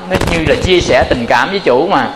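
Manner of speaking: ordinary talk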